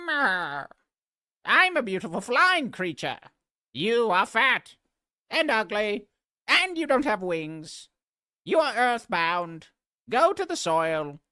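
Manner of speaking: high, loud, squeaky creature rant; a sneering butterfly screeching at a worm; shrill butterfly voice. Acted. Butterfly screeching insults down at a fat caterpillar.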